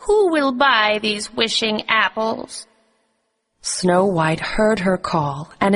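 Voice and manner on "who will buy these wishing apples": put-on disguised queen as an old crone, crying her apples; crying out wares. A narrator's reading. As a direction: hawk the apples in a high put-on old-lady voice. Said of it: "she cried".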